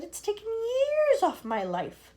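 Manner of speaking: high, loud little voice; a shaken ladybird. Acted. Ladybird agreeing, shaken.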